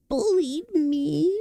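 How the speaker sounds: high, slow, pitiful fire-demon whine; piteous muffled voice. A narrator's reading. Fire demon Calcifer complains to Howl, piteous and muffled. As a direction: whine it, sorry for yourself. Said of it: "in a piteous, muffled voice".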